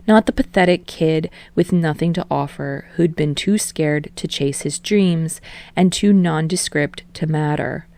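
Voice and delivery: usual speaking voice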